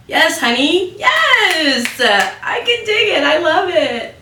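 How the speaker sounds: very high, loud sassy cheer; sassy diva voice. Pretend.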